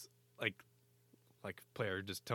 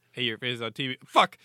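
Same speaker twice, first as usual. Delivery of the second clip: mock outburst; 3 semitones higher, 12 dB louder than usual. Coach told he is on TV, mock shout. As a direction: explode on the last word. Imitating the earpiece then coach.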